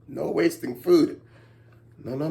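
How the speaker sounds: plain reading voice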